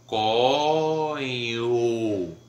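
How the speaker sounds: có stretched very slowly into a long o; slow vowel glide. Shown as a demonstration. Teacher shows how có turns into o, very slowly. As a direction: draw có out until it melts into o. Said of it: "você vai transformar esse".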